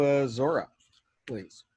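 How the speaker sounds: usual speaking voice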